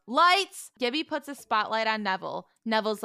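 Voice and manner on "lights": high, loud single shout; yelled command. Acted. Fred yelling for lights in the ring, shouted. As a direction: yell the cue across the arena. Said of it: "and yells".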